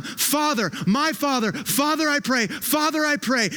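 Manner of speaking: high, repeated impassioned calls; insistent, heartfelt pleading address; fervent prayer. Retold or imitated. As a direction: call out to a father in prayer. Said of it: "It speaks of intimacy and nearness".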